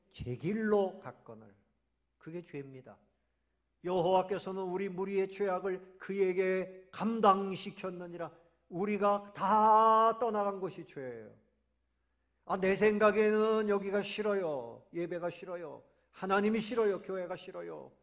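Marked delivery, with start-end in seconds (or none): 3.8-11.3 preaching loud. Real, not acted. Preaching at full force. 12.5-17.9 complaining churchgoer. Retold or imitated. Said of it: "떠나가는 겁니다".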